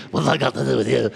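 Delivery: fast, rough demon growl; mock demonic voice. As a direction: rattle it off like one possessed. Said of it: "I don't think Jesus said to Mary".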